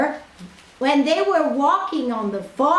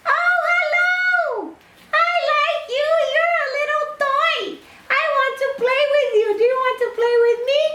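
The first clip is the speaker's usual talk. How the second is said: very high, fast excited princess voice; a giddy little princess gushing; 12 semitones higher, much faster than usual. Acted. Princess gushes at the tiny boy, high and fast.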